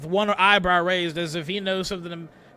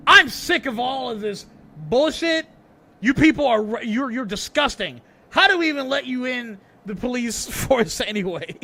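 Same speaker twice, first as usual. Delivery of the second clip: raised, loud, angry voice; disgusted outrage; 6 semitones higher, 6 dB louder than usual. Acted. Sanchez fed up with the sergeants, angry and loud. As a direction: scold them loudly at a high pitch.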